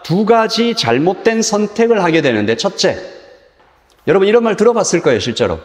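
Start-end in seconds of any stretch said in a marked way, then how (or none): none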